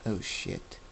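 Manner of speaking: breathless hushed whisper. Acted. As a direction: whisper it in awe. David sees Jacob transform.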